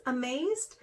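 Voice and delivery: usual speaking voice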